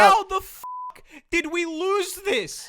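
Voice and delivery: loud, high, disbelieving rant; exasperated outburst. Real, not acted. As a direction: blurt it out in outrage. Exasperated outburst at losing war.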